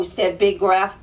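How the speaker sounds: plain reading voice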